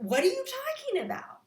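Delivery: high incredulous question; baffled. Retold or imitated. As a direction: pitch jumps way up in disbelief. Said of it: "You're like".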